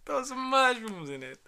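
high, slow mock Italian; bad Italian accent. Echoing the order in a hammy Italian voice. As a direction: ham up a cartoon Italian chef. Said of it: "Wow, that was horrible".

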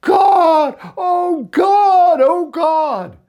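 high, loud, desperate crying out to God; a mother's panicked cry to God, loud and high; retold anguished scream. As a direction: scream it as a mother watching her son drown. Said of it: "she screamed and cried".

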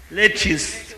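imitated American accent on "lettuce". Preacher quoting how she called it, mock American accent. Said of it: "is an American accent ... she called it".

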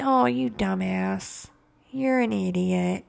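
low, slow pitying put-down; pitying quiet sneer; soft condescending insult. Retold or imitated. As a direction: talk down to them gently and slowly.

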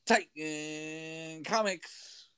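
very slow, drawn-out sing-out; Jeremy's phone greeting. Retold or imitated. Imitating the comic shop owner answering the phone, drawn-out greeting. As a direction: stretch the first word long and high. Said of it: "he always answers it".

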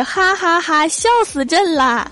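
high, slow hammed-up laugh line; mock-imperial chuckle, drawn out. Brother mocking the narrator's blunder, high fake laughter. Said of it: "我哥听完的反应是".

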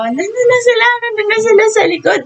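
high, fast excited crowd call; retold crowd shout. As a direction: shout like the screaming fans that they're backstage. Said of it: "sumisigaw na yung mga tao".

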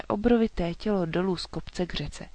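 ordinary delivery, nothing marked

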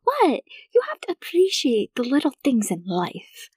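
whispered, high mock-earnest advice; mom's whispered wisdom. Mom answering her laughing daughter, whispered. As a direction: breathe it like a cheesy guru. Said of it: "She's like".

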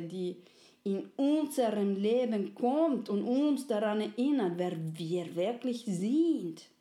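slow, grave warning; friendly soul. Acted. Friendly soul warns they may forget, slow and grave. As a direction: warn gently of getting lost.

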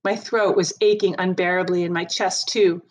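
usual speaking voice

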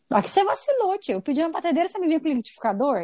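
put-on high, indignant child's whine; bratty whine. Spoiled child whining to her aunt about the wrong gift, high and indignant. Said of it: "E eu falei".

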